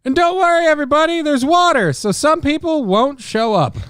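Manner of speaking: put-on high, cheery old showman voice; chirpy old star bragging; old entertainer voice.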